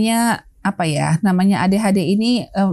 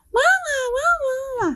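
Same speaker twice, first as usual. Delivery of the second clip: put-on high, slow whining child crying for mama; a kid's high tearful whine; whiny child calling; 3 dB quieter, much slower than usual. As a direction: whimper it like a small child.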